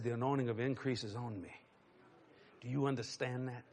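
low, hushed, earnest address; quiet, intimate pastoral voice; solemn blessing. Retold or imitated. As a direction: speak softly and gravely to her alone. Retells declaring blessing to cashier.

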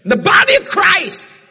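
high, slow, loud preached line; preaching. Acted. Preacher to congregation, slow loud solemn line. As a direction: drag it out for weight.

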